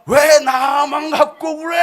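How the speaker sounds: slow, high mock-furious wail, building to a shout; acted indignant outburst. Enraged general complaining at the prophet's door, hammed-up angry shout. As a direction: protest as if singled out, stretching every word. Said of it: "열받은 거예요".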